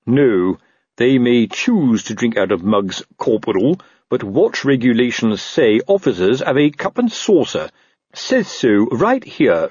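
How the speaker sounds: slow officer reciting regulations; condescending, drawn-out officialese; pedantic captain. Acted. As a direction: lay down the rules ponderously. Narrator voices pedantic Colon.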